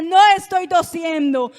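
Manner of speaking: loud, defiant denial; contradicción desafiante. Retold or imitated. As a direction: contradict the witch at full volume. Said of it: "Todo lo que ella me decía, yo lo contradecía". (negación fuerte y desafiante)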